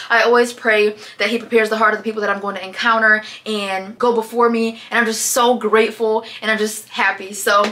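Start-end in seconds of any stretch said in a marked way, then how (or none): none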